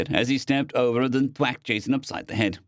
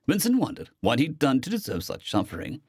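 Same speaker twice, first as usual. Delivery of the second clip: ordinary delivery, nothing marked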